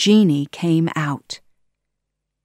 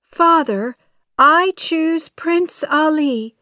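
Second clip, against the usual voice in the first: much higher, louder, slow declaration; pleading princess; 10 semitones higher, 4 dB louder than usual. A narrator's reading. Princess telling her father her choice, high and insistent. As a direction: declare it slowly in a raised voice.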